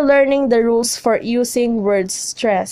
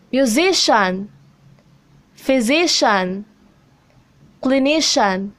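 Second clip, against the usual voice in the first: muSIcian, phySIcian, cliNIcian, second syllable stressed; stress before suffix. Shown as a demonstration. Teacher reads examples musician, physician, clinician, each stressed before the suffix. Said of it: "on a syllable right before the suffix".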